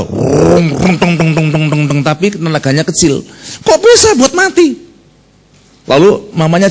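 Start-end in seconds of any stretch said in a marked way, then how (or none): 0.1-2.0 rung-tong putter of a bajaj motor, spoken; bajaj engine noise. Retold or imitated. Preacher mimics a Jakarta bajaj engine for the congregation, sputtering noise. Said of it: "Lihat apa bajai kalau di Jakarta?".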